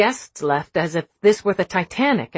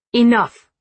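loud cutting command; sharp; 5 semitones higher, 7 dB louder than usual. Acted. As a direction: snap it to stop the room. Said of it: "her mother said sharply".

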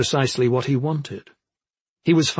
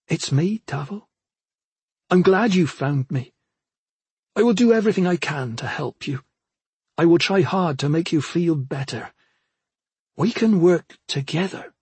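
higher, gentle, earnest promise; reassuring sister; 5 semitones higher than usual. A narrator's reading. Sister sensing a chance to win back her brother, reassuring. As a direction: reassure him carefully, pitched up.

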